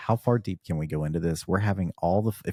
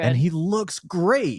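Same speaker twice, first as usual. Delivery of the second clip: high, loud, drawn-out amazement; excited gushing; 12 semitones higher, 5 dB louder, much slower than usual. Real, not acted. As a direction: gush in slow, high-pitched delight. Gushing over Wesley Snipes as Blade.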